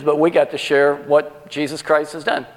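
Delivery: ordinary delivery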